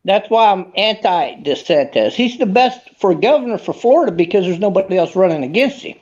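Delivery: normal talking voice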